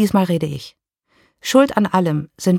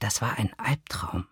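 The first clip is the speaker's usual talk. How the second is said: lower, softer breathy whisper; faint whisper; 8 semitones lower, 10 dB quieter than usual. A narrator's reading. Dying ex-lover to the woman, faint whisper. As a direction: barely voice it, worn out.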